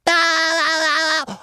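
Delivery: long, high cartoon wail; drawn-out cry. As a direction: punctuate the gag with a held, high "aah". Cartoon cry after the toilet joke.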